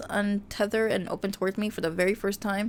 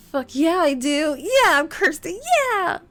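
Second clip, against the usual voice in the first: excited cheering; 7 semitones higher, 7 dB louder, slower than usual. Real, not acted. Cheers for her own romance choice.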